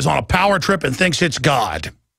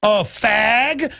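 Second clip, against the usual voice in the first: mocking bully; 6 semitones higher, slower than usual. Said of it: "I've seen it".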